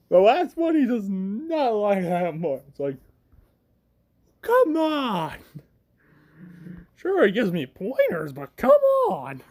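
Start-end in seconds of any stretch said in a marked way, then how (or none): 4.4-5.4 high, drawn-out mock whine; exasperated protest. Hero voiced protesting sidekick label. 8.3-9.4 very high, hammed-up complaint; exasperated protest. Hero voiced protesting sidekick label.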